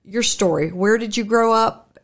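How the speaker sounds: ordinary delivery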